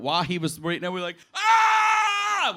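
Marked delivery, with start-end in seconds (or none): 1.3-2.5 high, loud terrified scream. Retold or imitated. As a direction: let out a panicked shriek. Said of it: "We just screamed".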